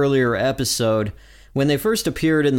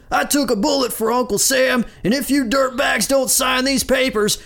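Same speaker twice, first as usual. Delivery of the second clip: high, strained sergeant tirade; angry yell; 13 semitones higher than usual. A narrator's reading. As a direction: threaten recruits at a sustained high yell. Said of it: "holds up a gun and yells".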